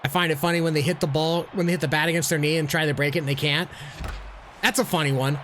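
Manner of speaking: ordinary talk, nothing marked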